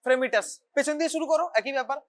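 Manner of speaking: ordinary talk, nothing marked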